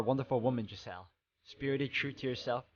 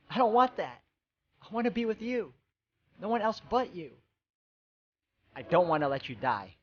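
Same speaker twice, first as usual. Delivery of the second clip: tearful refusal; 7 semitones higher than usual. Acted. Giselle refusing to let Mikkel die for her, anguished high cry. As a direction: cry out against losing him.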